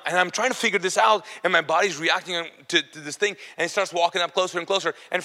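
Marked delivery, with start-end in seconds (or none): none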